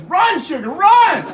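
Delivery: high, loud urging shout; imitated driver hollering encouragement; urgent bus driver yell. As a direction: send them sprinting with a high yell. Bus driver sending passenger running.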